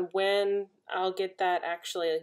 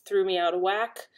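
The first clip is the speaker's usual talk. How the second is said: ordinary delivery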